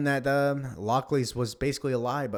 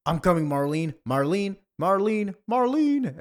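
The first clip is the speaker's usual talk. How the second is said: loud calls climbing higher each time; calling out urgently; 8 semitones higher, 6 dB louder than usual. Acted. Hero rushing to Marlene, calling her name louder and higher. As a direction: build to a high, loud cry of the name.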